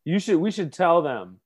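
ordinary delivery

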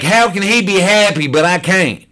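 high, loud, anguished protest; worked-up outburst. Retold or imitated. Newcomer asking the room why others are happy, loud and worked up. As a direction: cry out at the unfairness. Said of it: "I may end up getting loud".

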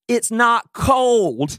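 high, very slow, deliberate reply; matter-of-fact retort. Retold or imitated. Husband answering his wife about the jacket, slow and deliberate. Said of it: "this is what I said".

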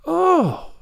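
high amazed Oh. A narrator's reading. Girl amazed Marilla never imagines, high amazed gasp. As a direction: gasp in wonder.

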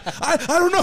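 high, stammering panicked reply, acted out; mock-frightened squeak; flustered. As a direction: answer like a cornered recruit. His flustered answer to sergeant.

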